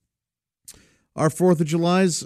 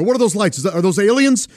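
high, louder pretend panic; mock alarm; 7 semitones higher, 3 dB louder, faster than usual. Uncle fooling niece about aliens, high hammed-up alarm. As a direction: play silly scared for a five-year-old. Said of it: "I go".

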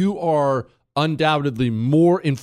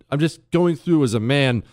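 ordinary talk, nothing marked

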